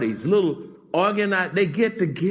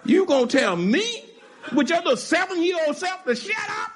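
outraged parent; 9 semitones higher than usual. Acted. Parent voiced rebuking a child.

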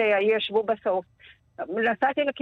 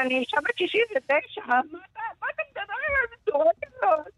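real crying; 5 semitones higher than usual. Real, not acted. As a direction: voice breaking into tears, pitched high. Retelling threats, breaks into tears. (בוכה באמת, הקול נשבר)

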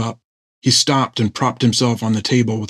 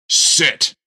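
severe order; 5 semitones higher than usual. A narrator's reading. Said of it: "It was an order".

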